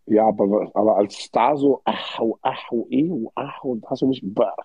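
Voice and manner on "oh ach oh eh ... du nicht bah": ordinary talk, nothing marked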